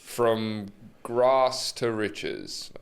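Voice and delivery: very slow, drawn-out title announcement; grand title reveal. Pretend. Podcaster pitching a film title, slow and grand.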